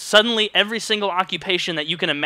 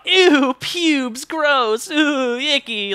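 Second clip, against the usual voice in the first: high, prissy mock disgust; mock squeamish voice; 10 semitones higher, 3 dB louder, much slower than usual. Mocking the voters who chose skunk, in a high prissy voice. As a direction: sneer at them in a dainty high voice.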